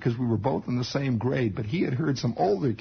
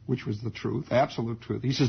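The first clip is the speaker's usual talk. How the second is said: normal talking voice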